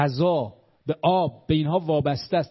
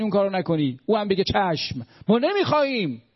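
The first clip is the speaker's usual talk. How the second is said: higher, driving sermon voice; fervent preaching; 6 semitones higher than usual. Acted. Speaker rallies listeners against America, fervent preaching. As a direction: preach it with rising passion.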